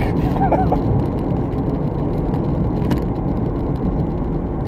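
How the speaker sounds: extended high-pitched laughter; long high laugh. Real, not acted. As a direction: let a long laugh roll. Laughs at own insult.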